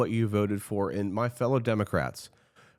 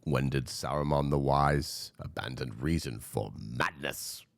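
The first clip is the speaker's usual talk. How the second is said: lower, grave wizard voice; lower, like an old wizard rebuking a friend; Gandalf; 6 semitones lower than usual. Acted. Quoting Lord of the Rings to viewers, lower grave wizard voice. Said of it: "I could go to Lord of the Rings".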